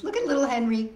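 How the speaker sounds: ordinary delivery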